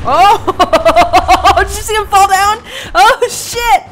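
high, loud laughing exclamation; delighted laughing shout. Real, not acted. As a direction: cackle at the fall. Watching companion fall down.

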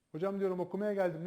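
ordinary delivery

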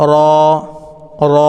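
র said alone with its vowel held; tongue tip to upper gum, sound র; demonstrated letter sound. Shown as a demonstration. Teacher showing learners the letter, careful isolated র. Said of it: "এইভাবেই আমরা".

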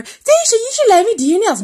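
high-pitched imitation of badgering; pestering friend. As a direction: mimic a nagging friend in a high voice. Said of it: "Every time I come over".

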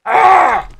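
sudden very high, loud yell of frustration; frustrated shout. Real, not acted. Gamer yelling at a disappointing loot drop, loud frustrated shout. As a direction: let out a sharp angry yelp.

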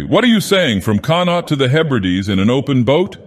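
high-pitched, incredulous outburst; sharp cry. A narrator's reading. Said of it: "cried the fisherman sharply".

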